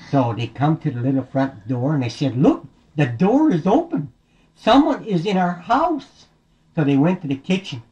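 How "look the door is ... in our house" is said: higher, mildly alarmed story voice; lightly acted surprise at a discovery. Bears find their door open.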